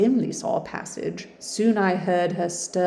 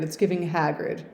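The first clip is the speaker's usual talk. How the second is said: usual speaking voice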